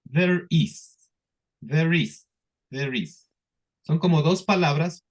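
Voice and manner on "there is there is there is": There is run together as one word; linked there is. Shown as a demonstration. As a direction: join There and is smoothly. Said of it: "Sería there".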